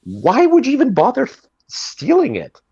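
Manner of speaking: high-pitched disbelief, stammering into it; baffled, voice leaping way up on the question; incredulous. Real, not acted. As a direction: ask it in sheer high disbelief. Incredulous at joke thief's logic.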